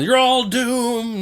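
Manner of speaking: slow ominous raspy drawl; Crazy Ralph voice. Retold or imitated. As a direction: drag out the doom warning. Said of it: "He was the one".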